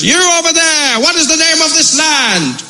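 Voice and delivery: high, slowed hail to strangers; pompous explorer calling ashore; calling from the ship. Acted. Explorer on the ship calls to the locals, high pompous hail.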